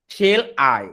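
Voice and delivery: "Shall I" in separate, weighty syllables; syllable-by-syllable scansion. Shown as a demonstration. Teacher showing students the ten syllables, "Shall I" measured and emphatic. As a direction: count out "Shall I" beat by beat, firmly. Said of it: "দশটা করে syllable থাকে".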